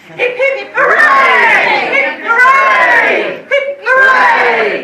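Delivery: high, loud toast cheer; joyful group hip hip hooray; cheering shout. Real, not acted.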